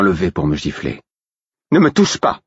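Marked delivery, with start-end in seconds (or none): 1.7-2.4 high, loud angry warning; sharp outburst, voice raised; angry growl. A narrator's reading. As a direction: warn him off furiously. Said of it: "gronda-t-elle".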